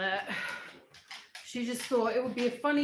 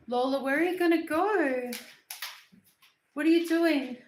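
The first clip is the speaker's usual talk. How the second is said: higher, louder talk to a dog; lively questions pitched up for a pet; doting pet talk; 4 semitones higher, 6 dB louder than usual. Real, not acted. Owner asks her dog where she's off to, doting pet talk.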